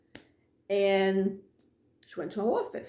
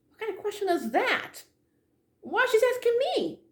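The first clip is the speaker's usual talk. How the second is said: much higher, incredulous voice; indignant disbelief; 12 semitones higher than usual. Retold or imitated. To the director on the phone, indignant and much higher. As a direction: make it sound like an absurd question. Baffled by director's odd question.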